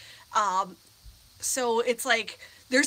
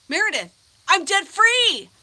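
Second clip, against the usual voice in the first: excited shout; 11 semitones higher, 12 dB louder than usual. Real, not acted. Greeting a viewer, celebrating.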